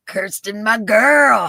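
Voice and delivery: loud shout-out to a favourite viewer; loud fond call. Real, not acted. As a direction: boom it out with affection. Greeting viewer.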